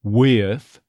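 "With" stressed as wi-uhth; off-glide "With". Shown as a demonstration. Coach shows the stressed Texas form, gliding "With". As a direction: slide from ih to uh in "With". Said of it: "get that same off glide".

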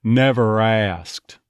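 slow Texas "Never asked." with a hard R; slow Texas accent demo. Shown as a demonstration. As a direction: drag "Never asked." with a bunched R. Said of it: "Really almost swallow that R".

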